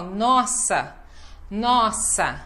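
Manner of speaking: "nossa" with a long, strong NOS; slow "NOS-sa", falling after the first syllable; stress on first syllable. Shown as a demonstration. Teacher using a song lyric as example, stressed NOS in "nossa". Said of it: "é aqui que vai realmente cair o stress".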